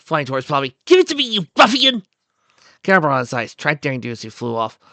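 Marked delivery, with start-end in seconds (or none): 0.8-2.0 high, loud indignant demand; demanding shout. Acted. Daring Do flying at Bobby, demanding shout. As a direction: shout it high, like an affronted heroine.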